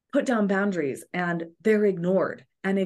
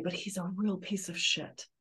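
much softer, under the breath; held-back aside; 9 dB quieter than usual. Retold or imitated. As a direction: let it slip out quietly, holding it in. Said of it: "holding back on the desire to say".

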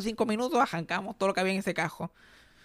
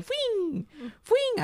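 very high squeaky announcement; hammed-up ending. Playing the toy on the wall, high comic squeal. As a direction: squeal it like a puppet's finale. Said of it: "como la Comay".

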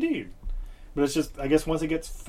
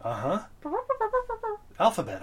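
usual speaking voice

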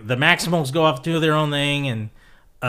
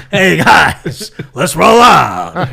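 put-on loud, slow New York accent shout; booming Brooklyn-accent rallying cry; New York Optimus; 4 semitones higher, 11 dB louder, much slower than usual. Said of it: "He would sound like this".

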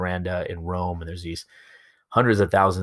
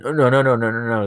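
rapid mock denial, high and loud; acted flustered denial; caught-out backpedaling; 4 semitones higher, 7 dB louder than usual. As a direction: wave it away hastily like someone caught out. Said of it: "when somebody caught him on it".

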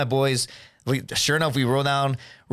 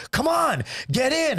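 much higher, excited retold call; mock eager urging; 10 semitones higher, slower than usual. Retelling waving girls into the car, mock eager urging. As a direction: beckon them in, playfully acted.

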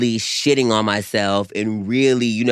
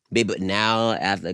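ordinary delivery

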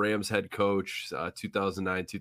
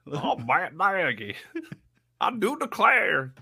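much higher, laughing mock Southern gentleman; Southern gentleman parody; 11 semitones higher, 3 dB louder than usual. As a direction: declare it like a fussy old Southern uncle. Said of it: "an uncle of the Cornish hen".

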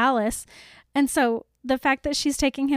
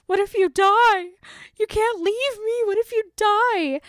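hammed-up panic, much higher, a bit louder; high frantic mock wail; mock panicked pleading; 8 semitones higher, 3 dB louder than usual. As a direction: play a clingy friend begging her not to go. Imagining Willow as clingy Bella.

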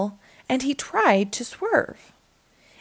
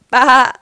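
loud sheep bleat; big storybook sheep noise; sheep baa; 9 dB louder than usual. A narrator's reading. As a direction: bleat it out loudly. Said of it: "Sheep said".

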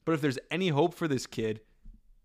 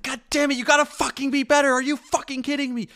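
much higher, louder furious yelling; imitated angry yell; 13 semitones higher, 6 dB louder than usual. Rodgers yelling at a teammate on the sideline, imitated furious yell. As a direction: blow up at a teammate over a mistake. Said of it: "He's yelling".

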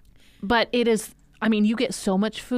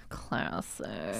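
whisper; 13 dB quieter than usual. Real, not acted. Whispered reaction.

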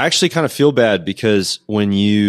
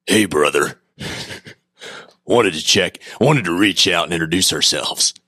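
impersonating the friend. Retold or imitated. Podcast host mimics his friend's old message, lightly voiced. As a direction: lightly mimic your buddy's greeting. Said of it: "my impersonation of you, like, exactly how you sound".

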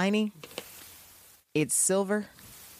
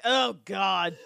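disgusted. Retold or imitated. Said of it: "even Maggie's like".

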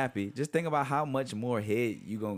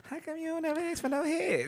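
mock woman's voice. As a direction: imitate the girlfriend complaining in a high voice. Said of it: "She gonna be".

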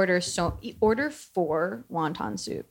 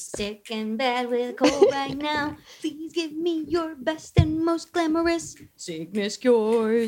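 attempted old antebellum Georgia accent, higher with a laugh; 7 semitones higher than usual. Repeating a listener's question in an attempted Georgia accent. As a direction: ham up a Southern Georgia belle accent. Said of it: "like, old antebellum Georgia".

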